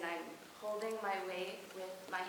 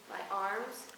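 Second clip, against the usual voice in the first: usual speaking voice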